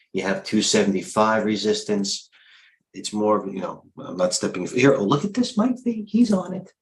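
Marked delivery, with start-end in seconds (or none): 4.9-6.7 high, thrilled pitch jump; sudden high-pitched excitement; excited. Real, not acted. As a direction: squeal it like you just spotted gold. Spotting a big trade.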